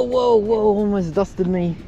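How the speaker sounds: usual speaking voice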